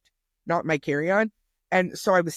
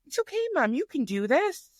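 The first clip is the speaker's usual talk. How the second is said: son reassuring mom; 4 semitones higher than usual. Retold or imitated. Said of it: "He said".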